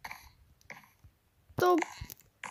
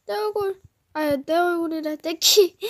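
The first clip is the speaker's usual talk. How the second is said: ordinary talk, nothing marked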